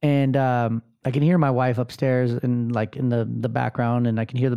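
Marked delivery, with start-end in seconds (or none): none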